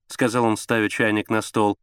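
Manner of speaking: ordinary delivery, nothing marked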